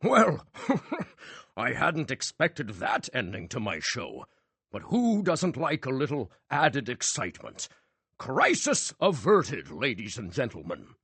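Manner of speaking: slow, laughing, showy recovery; breathless showman. A narrator's reading. Magician laughing off the chaos to the audience. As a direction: laugh it off for the crowd.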